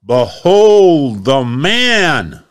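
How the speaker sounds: loud, slow presentation to a crowd; proclaiming. Acted. Pilate showing Jesus to the crowd, loud slow proclamation. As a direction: announce him to the whole square.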